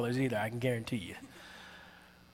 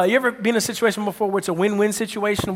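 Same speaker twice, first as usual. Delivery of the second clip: ordinary delivery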